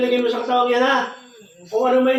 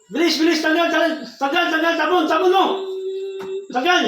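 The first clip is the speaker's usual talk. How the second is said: urgent shouted commands; 8 semitones higher than usual. Acted. Healer urging spirit to leave.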